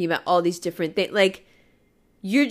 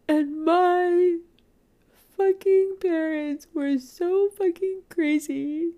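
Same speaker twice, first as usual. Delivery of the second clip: tearful and high, slow; crying; 10 semitones higher, slower than usual. Real, not acted. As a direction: sob it out about the parents. Crying about parents.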